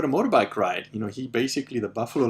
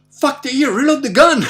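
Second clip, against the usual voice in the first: high, very loud imitated order; dad's urgent yell; 13 dB louder than usual. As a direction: shout it like a father in mid-hunt urgency.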